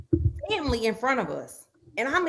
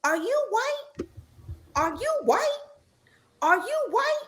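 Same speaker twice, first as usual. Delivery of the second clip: repeated high questioning scold; aunt scolding; 12 semitones higher than usual. Retold or imitated. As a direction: imitate the aunt's shocked scolding, pitched way up. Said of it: "and she says".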